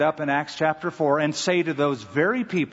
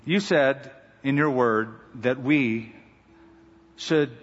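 pastoral prayer. Acted. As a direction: pray over the flock with reverent weight. Pastor leads closing prayer.

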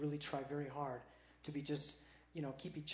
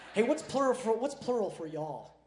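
loud, raised mock Nashville accent; jokey Southern accent; 8 semitones higher, 11 dB louder, slower than usual. Shown as a demonstration. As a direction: play up a Southern twang, drawing out the end. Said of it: "Can you talk in an accent like they do in Nashville?".

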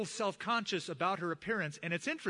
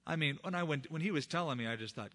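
ordinary talk, nothing marked

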